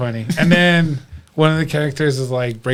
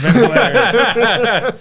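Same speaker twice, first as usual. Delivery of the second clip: laughing; 9 semitones higher, 3 dB louder, much slower than usual. Real, not acted. Joking about watching it drunk.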